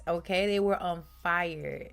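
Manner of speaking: normal talking voice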